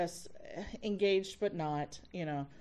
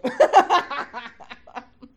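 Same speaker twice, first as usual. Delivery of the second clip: hearty, loud laughter; loud delighted laughing; 6 semitones higher, 15 dB louder than usual. Real, not acted. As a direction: laugh hard at your own story. Teller laughs at own story.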